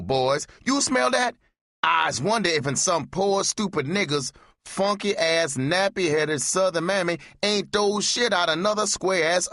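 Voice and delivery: very high, caricatured taunting voice; mocking minstrel voice. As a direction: pitch way up and lampoon them. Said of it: "I said".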